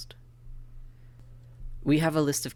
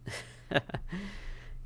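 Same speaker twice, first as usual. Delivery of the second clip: high airy laugh rising up; 10 semitones higher, 5 dB quieter than usual. Acted. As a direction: let out a light rising laugh. Reacting to an amusing answer.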